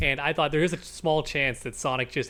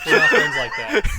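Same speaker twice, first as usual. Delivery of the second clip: laughing squeal; 8 dB louder than usual. Real, not acted. Jokey outburst, laughing.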